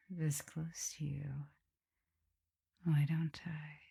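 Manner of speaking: intimate whisper leaning in close. Acted. Vampire whispers close to her captive lover.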